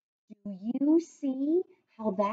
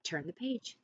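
usual speaking voice

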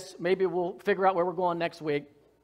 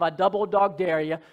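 ordinary delivery

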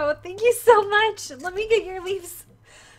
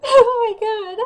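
real tearful, louder gasp of disbelief; crying; 3 semitones higher, 6 dB louder than usual. Real, not acted. Streamer stunned by a huge donation, crying. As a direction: cry it out, overwhelmed. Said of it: "I'm just gonna be crying on stream".

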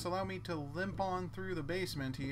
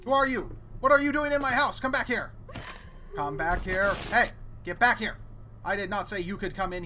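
loud, high startled shouts; yelling at intruder; 5 semitones higher, 7 dB louder than usual. Real, not acted. Player yelling at a stranger in his house, loud startled shouts. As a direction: chase them off with loud shouts.

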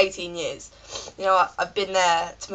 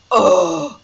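loud, raised "ugh"; exasperated groan; 5 semitones higher, 6 dB louder than usual. Retold or imitated. Passenger with a cold on a plane, exasperated groan. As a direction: let out a fed-up groan. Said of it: "even more just like".

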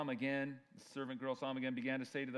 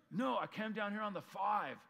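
higher-pitched mock Southern California accent; SoCal dialect; 8 semitones higher, 4 dB louder than usual. Denying you're from Southern California, mock SoCal accent. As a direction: protest like a SoCal local. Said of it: "you're from Southern California".

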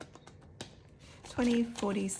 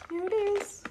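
excited reveal; 13 semitones higher than usual. Real, not acted. Presenting her challenge box.

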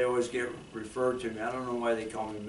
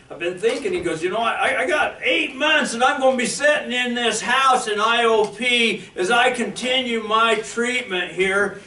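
excited retold quote; 11 semitones higher, 14 dB louder than usual.

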